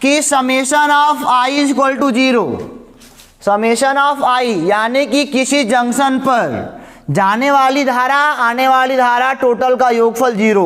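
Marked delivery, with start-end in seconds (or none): 0.2-4.5 slow dictation. Shown as a demonstration. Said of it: "book पढ़ोगे तो आपको ऐसे ही मिलेगा".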